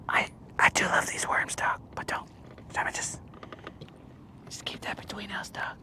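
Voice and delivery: hushed whisper, almost no voice; secret whisper. Real, not acted. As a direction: whisper it so nobody else hears. Secret aside to the dog.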